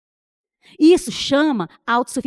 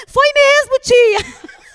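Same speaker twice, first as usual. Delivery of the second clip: higher, louder niece, struck by it; excited niece; 11 semitones higher, 7 dB louder, much slower than usual. Retold or imitated. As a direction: niece suddenly realising, exclaimed. Niece voiced in retold story.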